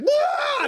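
very high mock wail; hammed-up kid's cry; imitated child's wail. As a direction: wail like a startled little boy. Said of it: "I think my son's like".

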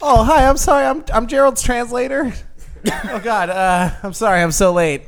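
high, loud, apologetic burst; flustered late arrival. Acted. Translator apologizes to host for arriving late, flustered and loud. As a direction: rush in loudly, apologizing with a laugh.

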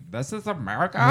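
put-on high, rising valley girl take. Shown as a demonstration. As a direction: say it like a question, upspeak.

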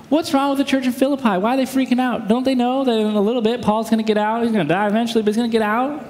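high, quick mock-exasperated questions; judgmental jerk. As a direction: rattle off incredulous complaints like a know-it-all. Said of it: "Don't read the New Testament like a jerk".

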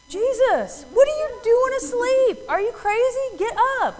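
high, loud mock panic; panicked, indignant imitation of frightened followers; freaking out. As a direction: yell in alarm to wake a sleeper, acted out. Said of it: "they're all freaking out".

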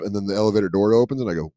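usual speaking voice

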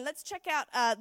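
normal talking voice

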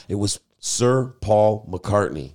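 normal talking voice

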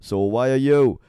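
loud jokey jab at the listener; mock challenge. Host teasing his audience with a loud jab. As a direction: throw the question at the audience, loud.

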